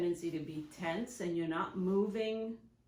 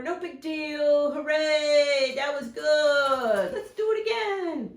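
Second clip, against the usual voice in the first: much higher, louder, slow cheerful hooray; happy cheering; 7 semitones higher, 9 dB louder, slower than usual. Shown as a demonstration. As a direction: keep the dog upbeat after a nick.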